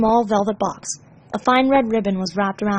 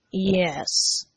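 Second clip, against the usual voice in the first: toneless, no emotion; bored, flat yes; 2 semitones lower, 3 dB quieter than usual. Acted. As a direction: say it dead flat. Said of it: "he said flatly".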